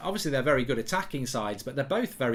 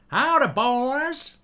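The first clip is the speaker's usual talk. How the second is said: drawn-out, loud American greeting; bad American accent; 9 semitones higher, 5 dB louder, much slower than usual. Shown as a demonstration. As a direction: badly fake a high American drawl. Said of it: "I can't do American accents".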